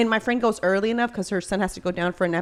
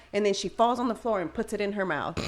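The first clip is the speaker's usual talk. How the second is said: normal talking voice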